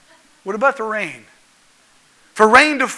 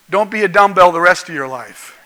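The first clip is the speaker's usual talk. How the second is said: normal talking voice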